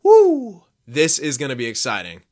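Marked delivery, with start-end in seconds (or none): 0.0-0.6 loud, high "woo!"; excited whoop. Real, not acted. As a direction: let out a big fan's whoop. Excited about Pittsburgh and Philly.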